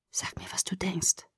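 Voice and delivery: soft unvoiced whisper. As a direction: breathe the words out. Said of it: "flüsterte er".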